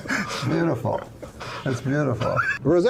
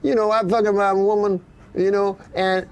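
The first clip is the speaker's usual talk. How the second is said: loud put-on voice; 7 semitones higher, 4 dB louder than usual. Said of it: "He goes".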